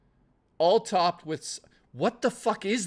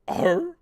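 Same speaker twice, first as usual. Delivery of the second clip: pirate growl; 12 semitones higher, 6 dB louder than usual. Pretend. Random outburst.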